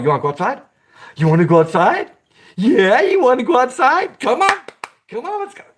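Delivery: talking to a dog. Retold or imitated. Imitating an owner coaxing his dog outside, rising to a high squeal. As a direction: coax the dog out, building up high and eager. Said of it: "It's like letting the dog".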